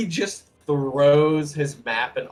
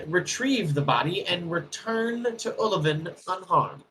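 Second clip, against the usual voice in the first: a touch higher, confident wizard boasting his plan; lightly voiced wizard; 7 semitones higher, 6 dB louder than usual. Dwarf wizard boasts his plan to the party, lightly voiced. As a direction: sell the plan like a proud little wizard.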